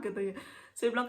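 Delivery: usual speaking voice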